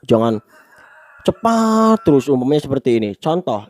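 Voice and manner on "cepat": "cepat" held long on a high note; monotone stretch. Shown as a demonstration. Teacher mocks unvarying speech, "cepat" drawn out high. Mimics unvarying delivery to avoid.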